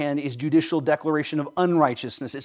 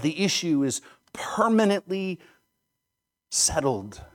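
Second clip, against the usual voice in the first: whispered, letting the words sink in; a preacher's whispered emphasis; hushed whisper; 3 dB quieter than usual. Real, not acted. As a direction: drop to a whisper on the final point.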